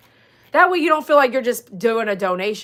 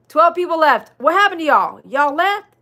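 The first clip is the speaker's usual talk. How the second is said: loud, high mock outrage; put-on disbelief, pitched up and louder; mock dismay; 7 semitones higher, 7 dB louder than usual. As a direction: playfully call out the viewers who left. Mock dismay, then just kidding.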